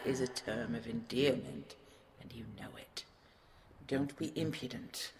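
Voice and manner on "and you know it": soft whispered tease; teasing whisper. Acted. Dragon teasing his love, whispered. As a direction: drop to a whisper.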